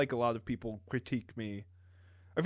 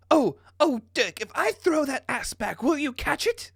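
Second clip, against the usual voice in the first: very high, loud put-on coo; hammed-up Jake; 11 semitones higher, 4 dB louder than usual. Jake flirting with Dirk, hammed-up high voice. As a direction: ham it up in a high, eager voice.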